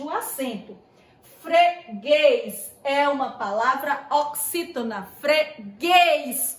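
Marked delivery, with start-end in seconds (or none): 1.4-2.7 Freguês split in two, leaning on the final guês; stress on last syllable. Shown as a demonstration. Teacher shows pupils the tonic syllable, stress on the guês of Freguês. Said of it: "O guês é a sílaba tônica". 5.2-6.6 Freguês broken up, with a louder, longer guês; stress on last syllable. Shown as a demonstration. Teacher shows pupils the tonic syllable, Freguês with guês stressed. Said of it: "O guês é a sílaba tônica".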